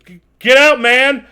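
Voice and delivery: loud high shout to get out. Acted. Shane yelling at the stranger: loud high shout. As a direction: yell at the intruder to leave. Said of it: "you hear Shane goes".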